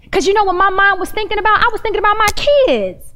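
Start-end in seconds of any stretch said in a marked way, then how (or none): none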